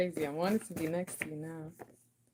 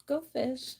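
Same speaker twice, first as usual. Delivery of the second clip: ordinary talk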